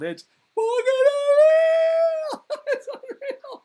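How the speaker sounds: very high, stretched-out squeal; amazed outburst. Real, not acted. Comedian raving about monkey video, squealing amazement. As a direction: squeal it slowly in sheer disbelief.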